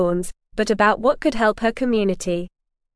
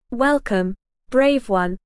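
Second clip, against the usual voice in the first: ordinary delivery, nothing marked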